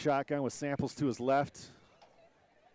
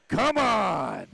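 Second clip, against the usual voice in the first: frustrated shout; 6 semitones higher, 6 dB louder than usual. Real, not acted. Reacts to flag on touchdown.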